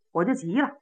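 ordinary delivery, nothing marked

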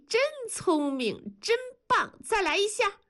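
put-on very high, gushing baby talk; delighted high-pitched mommy cheer; mother praising baby.

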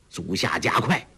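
ordinary delivery

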